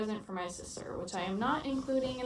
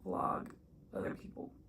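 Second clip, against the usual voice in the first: whispering; 4 semitones lower, 8 dB quieter than usual. Real, not acted. Whispering so others won't hear.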